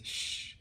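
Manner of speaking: soft, voiceless shush; a quiet "shh" so as not to be heard; hushing shush. Acted. Mole hushes turtle near alien.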